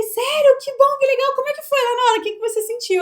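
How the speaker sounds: high, loud, delighted questioning; excited reaction. Retold or imitated. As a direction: re-enact thrilled surprise at a client's success. Retelling own excited reaction to client.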